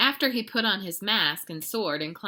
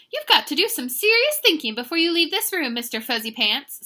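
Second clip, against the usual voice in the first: high, loud stern mama voice; emphatic parental telling-off; lecturing mother; 9 semitones higher, 9 dB louder than usual. A narrator's reading. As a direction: lecture a naughty child with a raised voice. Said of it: "The lecture went on and on".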